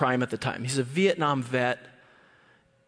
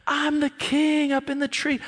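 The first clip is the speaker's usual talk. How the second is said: put-on high, gleeful boast of a little boy; kid boasting; 11 semitones higher than usual. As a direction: crow it like a proud kid on top of the world. Child boasting up in tree.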